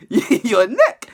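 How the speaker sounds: laughing disbelief. Real, not acted. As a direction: laugh the question out.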